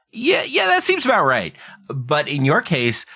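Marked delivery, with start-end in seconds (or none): 0.1-1.5 mock knowing agreement. Stroke survivor mimics people nodding along, high mock agreement. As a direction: play the unsurprised onlookers, pitched way up. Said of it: "people look at me and go".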